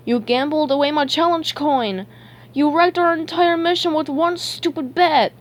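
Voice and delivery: high, indignant cartoon outburst; heated high-pitched scolding; angry accusation. A narrator's reading. SpongeBob accuses Patrick angrily.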